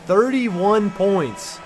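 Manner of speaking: high, loud, very drawn-out call; excited announcement. Real, not acted. Gamer announcing his rookie's huge score, excited. Celebrating the rookie's point total.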